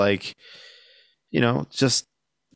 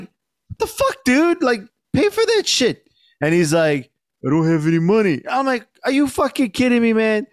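mock outraged yell; 9 semitones higher than usual. Shopkeeper catching a man eating stolen fruit, outraged yell. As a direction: let him have it, pitch way up. Confronting a guava thief.

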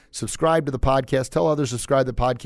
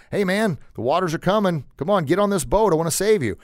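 higher-pitched urging from a rescuer; calling out; 7 semitones higher than usual. Retold or imitated. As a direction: call to a stranded man to climb aboard. Second rescuer calls from motorboat.

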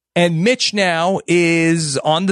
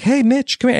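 seductive call; 7 semitones higher than usual. Retold or imitated. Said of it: "she's like".

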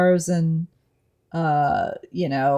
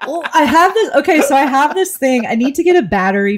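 excited; 8 semitones higher, 6 dB louder than usual. Real, not acted. Excited to share a gadget.

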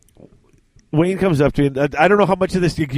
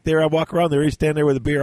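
ordinary talk

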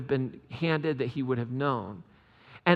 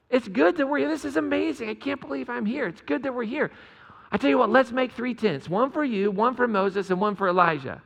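higher, eager Peter blurting out his plan; gushing Peter; 7 semitones higher than usual. Retold or imitated. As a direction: gush like a starstruck disciple who can't stop talking. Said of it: "he says".

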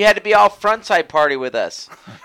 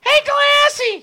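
sing-song call; much slower than usual. Pretend. Calling out to friend.

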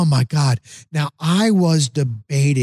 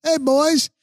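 high cheering yell; 11 semitones higher than usual. Retold or imitated. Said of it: "She's like".

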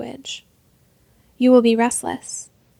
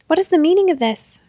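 raised, alarmed demand; shouted; 5 semitones higher, 4 dB louder than usual. A narrator's reading. Thief shouting at the woman, raised voice. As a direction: thief shouts at his captors. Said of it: "shouted the memory thief".

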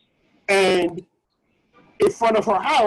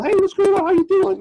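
high, loud, sing-song flirting; 12 semitones higher, 4 dB louder than usual.

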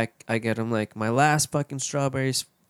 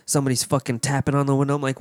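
normal talking voice

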